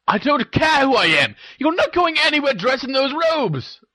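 angry brother shouting, pitch way up; thundering. A narrator's reading. Ron forbids his sister the robes, thundering. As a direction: thunder out the order in high-pitched outrage. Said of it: "thundered Ron".

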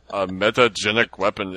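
lightly imitated Russian accent on "A metagenic weapon"; mock Russian Picard. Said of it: "And he talked like he was Russian".

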